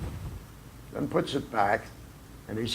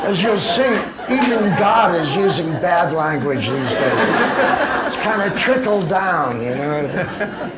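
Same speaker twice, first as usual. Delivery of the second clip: laughing; 6 semitones higher, 7 dB louder than usual. Real, not acted. Still laughing while telling the audience God swears now. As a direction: talk through laughter, higher and louder.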